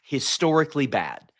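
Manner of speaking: ordinary talk, nothing marked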